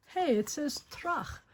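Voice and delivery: ordinary delivery, nothing marked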